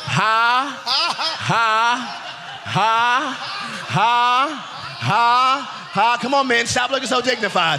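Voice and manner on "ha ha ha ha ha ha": separate, drawn-out "ha" calls; demonstrated forced laugh. Shown as a demonstration. Preacher urges congregation to laugh, deliberate forced laugh. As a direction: lead them with deliberate single ha-has. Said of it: "and laugh".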